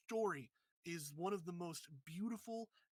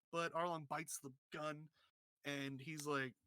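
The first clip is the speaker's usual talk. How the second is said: normal talking voice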